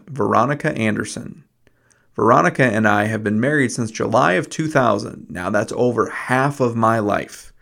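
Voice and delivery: polished radio host read; upbeat show intro read; podcast host intro. Acted.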